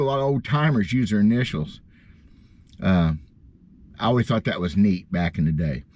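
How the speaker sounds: ordinary talk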